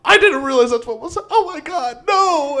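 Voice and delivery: high, loud, horrified realization; mortified. Retold or imitated. Teen [name] realizing the joke, loud mortified panic. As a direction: gasp out the dawning embarrassment. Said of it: "and I was just like".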